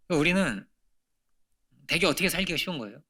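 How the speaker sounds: ordinary delivery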